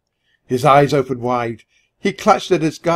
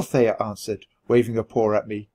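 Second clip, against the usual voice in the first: ordinary delivery, nothing marked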